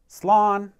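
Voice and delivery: loud, high model word; leading a drill. Shown as a demonstration. Teacher giving a goodbye for learners to answer, loud cue. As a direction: call it out high and loud for practice. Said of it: "I'm gonna practice saying slán".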